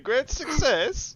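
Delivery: slow, laughing Borat impression; drawn out Borat catchphrase, through laughter. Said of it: "a gif of Borat doing".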